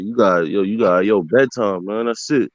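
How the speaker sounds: ordinary delivery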